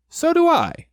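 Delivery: high, loud little bear voice; high-pitched storybook bear; Pooh. A narrator's reading. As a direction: pipe up high in agreement. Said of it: "said Pooh".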